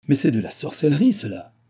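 high, loud cry, falling at the end; exclaimed. A narrator's reading. Baron stunned by the stranger's claim, crying out. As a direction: cry out in stupefaction, then drop away. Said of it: "s'écria-t-il".

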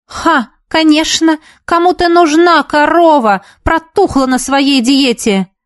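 high, drawn-out derision; scornful. A narrator's reading. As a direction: jeer at a rival, stretching the words. Insults in acted quarrel. (презрительно, с издёвкой)

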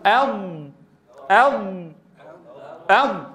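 "أض" three times, ض from the tongue's edge; a firm "أض", each one pressed; correct ض repeated. Shown as a demonstration. Teacher drilling students, repeating the correct "أض". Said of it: "شو هي؟".

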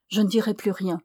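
ordinary delivery, nothing marked

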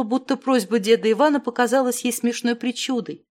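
ordinary delivery, nothing marked

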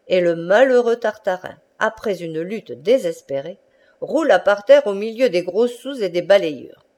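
ordinary delivery

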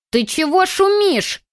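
put-on high, slow, quavering old lady voice; quavering old woman. A narrator's reading. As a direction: wail it like a cranky old granny. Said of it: "дребезжащим голосом взвыла она".

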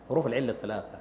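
quiet repeat. Shown as a demonstration. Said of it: "repeated for emphasis".

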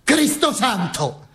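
high, drawn-out oath; scandalized priest. Acted. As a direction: exclaim it slowly and high, as a shocked priest. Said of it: "disse un prete". (esclamazione acuta da prete scandalizzato)

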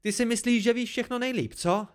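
high-pitched, accusing challenge; blurted angrily. A narrator's reading. Dena accuses the narrator of knowing best, blurting angrily. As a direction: fling the accusation at them.

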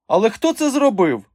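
high, loud questioning lady; alarmed circus madam. A narrator's reading. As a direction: demand the culprit in a high voice. Madam grows attentive, demands culprit. (стривожене високе запитання пані)

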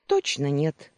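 ordinary delivery

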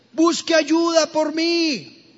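high, slow cry for help from the water; mock plea of a drowning man; drowning man's call. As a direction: shout it out across the waves.

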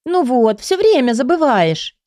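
high mock pout, complaining; whiny spoiled pouty drawl. A narrator's reading. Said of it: "наигранно надула губы".

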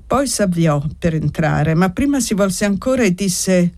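usual speaking voice

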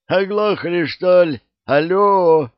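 loud, high shouting into a mic; yelling into radio. Acted. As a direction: bellow into the walkie-talkie. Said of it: "орёт в микрофон так, что закладывает уши".

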